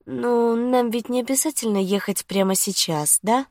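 high, put-on woman's voice; coaxing girlfriend. A narrator's reading. Lucy tries to delay the date, coaxing.

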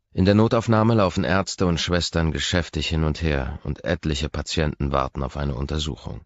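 plain reading voice

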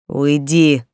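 barking. A narrator's reading. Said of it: "рявкнул он".

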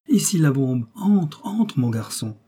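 brisk beckoning call; calling someone in. Acted. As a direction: call lightly to someone behind the door. Detective calls his man in.

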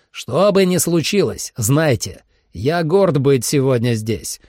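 rally the troops, weighty and proud, rising in force; rousing battle speech. A narrator's reading. Leader addresses hunters before battle, rousing speech building to roar. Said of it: "взревел он".

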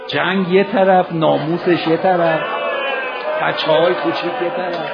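loud, slow, building sermon cadence; preaching at full force. Acted. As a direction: hammer each clause like a preacher at the pulpit. Rising sermon climax. (موعظه با صدای بلند و کشیده)

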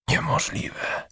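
breathless slow gasp of disbelief; airy, stunned gasp. Acted. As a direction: push it out on the breath, no voice. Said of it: "sapnęła recepcjonistka".

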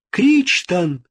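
a sharp high cry of his name. A narrator's reading. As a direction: call out to him in alarm. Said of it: "вскричала Эклермонда".

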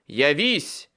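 loud commanding cry; shouted summons. A narrator's reading. Wizard summoning a creature, loud commanding cry. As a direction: hurl the command into the circle. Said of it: "вскричал я ещё раз".

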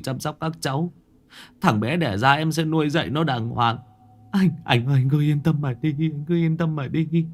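tearful promise. A narrator's reading. Younger brother tearfully promises his dead brother, whimpering. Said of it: "Ông Đạn mếu máo".

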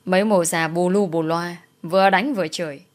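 ordinary delivery, nothing marked

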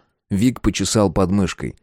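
plain reading voice

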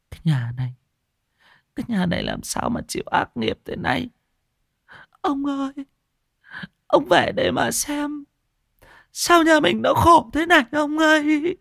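put-on wailing old woman calling on her dead husband; lamenting grandmother. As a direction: start hushed, then rise into a high lament. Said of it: "mà than". (bà lão than khóc, từ thì thầm rồi vút cao kêu ông)

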